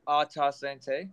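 slow, careful French accent on "Ah, ta sante"; French Canadian toast said slowly. Shown as a demonstration. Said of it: "always go proper this time".

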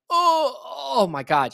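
high, loud, drawn-out groan; mock cry of pain. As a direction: wail it as if being stepped on. Retold pain under heavy pressure.

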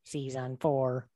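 slow flat robotic monotone. Pretend. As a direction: say it like a machine, slowly. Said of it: "I sound more robotic".

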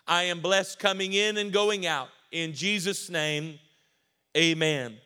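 loud, rising declaration; steady cadence. Real, not acted. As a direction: build it up loud like a proclamation. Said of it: "keep a steady cadence".

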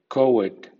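coed as CO-ed; strong first syllable in coed; strong stress on prefix. Shown as a demonstration. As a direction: hit the CO of coed hard. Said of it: "O con acento fuerte".

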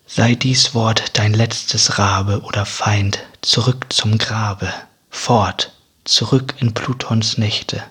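ordinary delivery

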